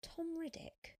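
high, unraised note of wonder; stunned thought. A narrator's reading. Sophia realising who the cyclist was, stunned. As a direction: think it aloud in amazement. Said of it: "she thought".

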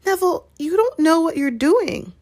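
high-pitched exasperated plea; exasperated protest. Acted. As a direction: plead with a friend, voice pitched right up. Ron exasperated, pleading with Neville.

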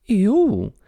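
very high surprised squeak; shrill. Acted. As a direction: squeal it in disbelief. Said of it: "disse estridentemente". (guincho agudo de surpresa)

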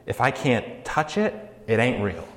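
skeptic. Retold or imitated. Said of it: "And so we say".

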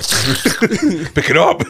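slow, high, laughing command; mock order, stretched out with laughter. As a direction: drag it out through a laugh.

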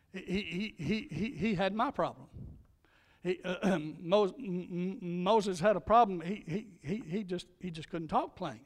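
stammering, repeated first sounds; playfully put-on stutter; mock stutter. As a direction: get stuck on the opening syllables, as if stuttering. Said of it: "'cause he stuttered".

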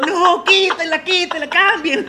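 high, slow pleading voice; mock crowd pleading. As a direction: beg them to change the song. Crowd begging to change song.

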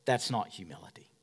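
unvoiced whisper; whispered aside. Real, not acted. Pastor correcting the church, in a whisper. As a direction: whisper it to the crowd.

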